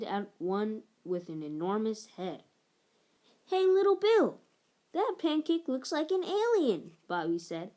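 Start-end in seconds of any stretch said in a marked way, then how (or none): 3.5-7.0 teasing big brother. A narrator's reading.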